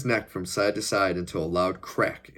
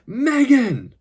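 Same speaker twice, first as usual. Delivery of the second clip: long, much higher, loud cry of her name; louder anguished cry; 10 dB louder than usual. A narrator's reading. Captive husband to his motionless wife, desperate cry. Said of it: "he cried out in anguish".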